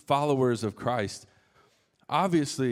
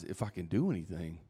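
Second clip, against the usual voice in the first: softer, echoing the father's words back; Jesus repeating the doubt; 5 semitones lower, 6 dB quieter than usual. Retold or imitated. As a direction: hand the doubt back gently. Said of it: "Jesus' response".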